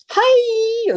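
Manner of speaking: put-on very high squealed Valley Girl "Hi!"; Valley Girl accent. Shown as a demonstration. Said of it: "Ben's doing the impression for you".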